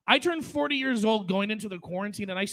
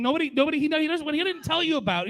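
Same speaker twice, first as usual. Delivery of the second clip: worked up; 7 semitones higher, 5 dB louder, faster than usual. Real, not acted. Exasperated build about snoring friend.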